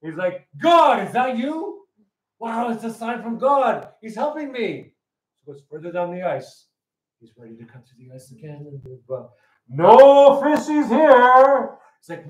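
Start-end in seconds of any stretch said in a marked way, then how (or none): 0.6-4.9 high, slow wonder at a voice from heaven; awed amazement. Acted. Joke guy awed by heavenly voice. 9.7-11.8 high, drawn-out booming call; loud booming voice. Acted. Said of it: "there's this loud booming voice".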